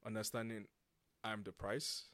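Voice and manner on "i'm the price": ordinary delivery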